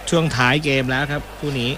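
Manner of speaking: brisk football commentary call; live play-by-play. Acted. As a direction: follow the action as it happens.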